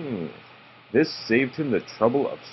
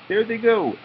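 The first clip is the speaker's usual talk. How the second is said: much higher squeaky voice; squeaky wood rat; 11 semitones higher than usual. Acted. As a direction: keep the little rat squeak going. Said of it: "he squeaked".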